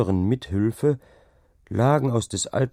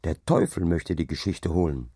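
plain reading voice

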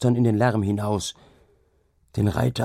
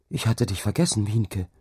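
ordinary delivery

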